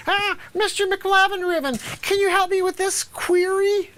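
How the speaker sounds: high, whiny imitation of a pupil; mocking student voice. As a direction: mimic an annoying student asking for help. Mimicking a student's question.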